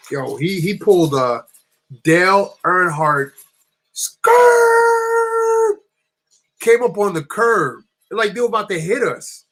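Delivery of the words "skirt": long high loud tire screech. Retold or imitated. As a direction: imitate a car skidding to a stop. Imitates car screeching to curb.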